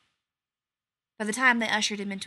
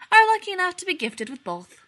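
much higher, louder boast; smug announcement; 10 semitones higher, 8 dB louder than usual. A narrator's reading. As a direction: proclaim it, very pleased with himself. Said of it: "Rodney McKay announced".

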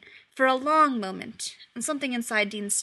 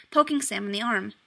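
ordinary delivery

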